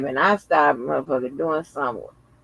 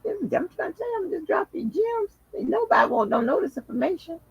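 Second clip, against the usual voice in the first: high playful voice; 3 dB quieter, faster than usual. Real, not acted. Emphatic teasing boast.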